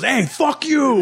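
mock comeback snapped way up high. Illusionist snapping back at the detective, mock high-pitched retort. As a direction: bark the comeback back in an imitated huff. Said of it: "He goes".

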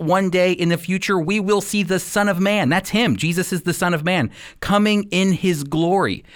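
a fervent radio preacher lifting his voice; rising preaching. Acted. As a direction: build the sermon up toward Jesus coming down. Impassioned run on Jesus coming down.

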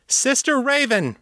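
high-pitched, ringing call; angry, high call for someone; shouted summons. A narrator's reading. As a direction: shout the name across a temple hall. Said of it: "Mirai shouted again".

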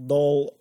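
DOLL punched loudly as "dole"; mock pronunciation. Repeating DOLL at co-host, loud mock pronunciation. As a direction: mimic the other's "doll" with an overdone O. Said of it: "I'm trying to talk like you".

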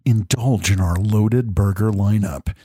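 ASMR voice. Shown as a demonstration. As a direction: murmur the menu like ASMR. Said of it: "my A S M R voice".

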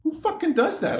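high-pitched retold outrage; outraged disbelief. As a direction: act out being appalled, voice shooting up. Retells outraged reply in argument.

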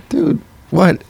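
high, disbelieving; incredulous. Retold or imitated. Actor baffled by the director's note, high and incredulous. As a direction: squeak it out in disbelief. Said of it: "And I was like".

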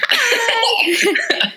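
giggly high greeting; laughing squeaky hi. Pretend. Silly repeated hi game.

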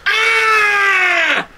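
bank robber. Acted. Robber threatening the clerk.

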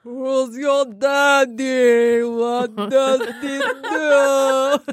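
very slow, drawn-out mock Russian accent. Podcaster asked to record the line slowly, mock Russian accent. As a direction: stretch every word out in a hammed-up Russian accent. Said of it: "Please make the Russian accent and then put it real slow".

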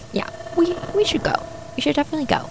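nervous high voice. Acted. Nervous character retreat.

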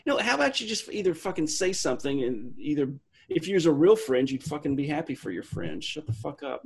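ordinary talk, nothing marked

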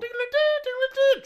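very high loud squeak; Diglett squeak. Pretend. Podcaster squeaks the Diglett cry very high. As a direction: squeal it like a tiny mole.

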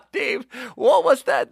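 usual speaking voice

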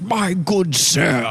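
slow, lofty mock Etonian accent; fake posh British accent. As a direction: drawl it like a snobby posh British gent. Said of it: "He's like".

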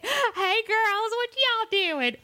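high, drawn-out Louisiana drawl; Louisiana accent. Acted. Wrestler greeting girls at the pool, put-on Louisiana accent. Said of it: "let me go with the Louisiana accent".

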